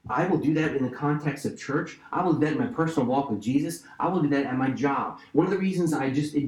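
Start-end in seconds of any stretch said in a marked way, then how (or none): none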